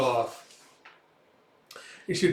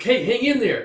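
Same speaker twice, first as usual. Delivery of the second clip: high, raised urging voice; urgent encouragement; 9 semitones higher, 5 dB louder than usual. Retold or imitated. Man urges comrade to hold on, urgent call.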